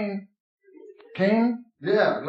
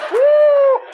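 put-on very high, very loud drunken whoop; 9 dB louder than usual.

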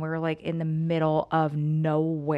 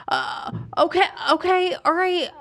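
high, overwhelmed stammer; flustered and shaking; 12 semitones higher, 4 dB louder than usual. Retold or imitated. Partner told to go get him, high flustered agreement. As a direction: reenact being rattled by huge news, pitched high. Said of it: "I'm, like, shaking".

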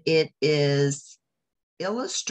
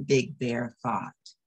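ordinary delivery, nothing marked

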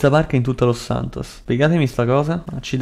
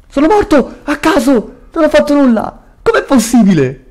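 urla acute e forti, indignate; protesta incredula; 13 semitones higher, 6 dB louder than usual. Real, not acted. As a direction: protesta gridando per una morte ingiusta. Protesta per la morte improvvisa. (incredulo, urla di protesta)

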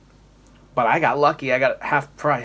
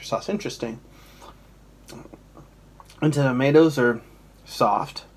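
muffled by held plate; speaking behind a plate. Real, not acted. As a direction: voice reflected off plate.

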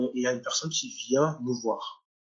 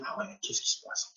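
whispered inner thought; 9 semitones higher, 8 dB quieter than usual. Retold or imitated. Inner thought, high unvoiced.

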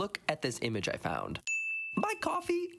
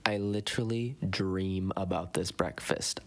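ordinary talk, nothing marked

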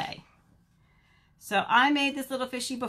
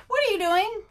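high, louder rabbit voice; curious rabbit; 11 semitones higher, 6 dB louder than usual. Acted. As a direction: pipe up high with a question.

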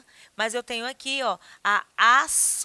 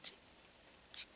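short soft tic; watch ticking; 22 dB quieter than usual. Shown as a demonstration. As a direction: click like a wristwatch. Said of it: "faz assim, ó". (tique de relógio de pulso)

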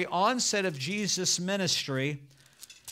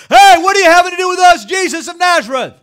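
screamed at the top of the lungs, much higher; a possessed man shrieking across the church; demon screaming; 12 semitones higher, 18 dB louder than usual. Acted. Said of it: "cried out the top of the lungs".

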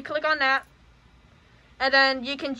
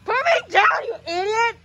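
panicked yell; 6 semitones higher than usual. Acted. Being picked up against his will.

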